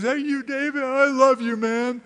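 high, sloppy mock drunk gushing "I love you, man". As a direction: play a weepy drunk who loves everyone. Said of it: "like a drunk".

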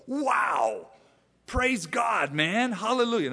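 high, loud surfer-dude "Wow! Praise God, man!"; surfer exclaiming. Retold or imitated. Preacher voicing Paul as an excited surfer dude. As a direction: burst out like a stoked surfer. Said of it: "that's a surfer's way of saying".

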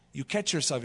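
usual speaking voice

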